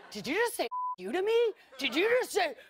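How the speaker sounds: retold confrontation rising to a shout on the last words; building angry retort. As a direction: climb from tight to an outright yell.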